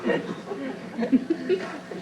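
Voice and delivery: drawn-out laughing repeat. Acted. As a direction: stretch it out with a laugh.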